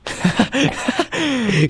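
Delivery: a sudden high burst of laughter. Real, not acted. Cohost laughing at the idea of doing accents all show, high laugh.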